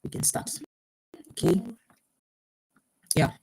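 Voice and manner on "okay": ordinary delivery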